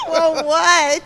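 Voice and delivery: high laugh breaking into words. Real, not acted. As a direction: laugh through it.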